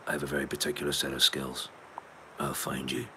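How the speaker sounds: low, soft menacing voice; film threat. Acted. Quoting a film threat to the listeners, quiet menace.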